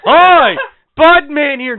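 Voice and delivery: very high, loud mimicry of a greeting; mocking the introduction. Co-host throws the guest's greeting back at him, mocking shout. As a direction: parrot the guest's hello in a mocking yell. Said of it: "It just said".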